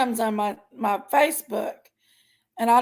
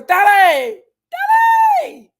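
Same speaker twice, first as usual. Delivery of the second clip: imitating a squeal; 10 semitones higher, 10 dB louder, slower than usual. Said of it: "he gets that little squeal in his voice".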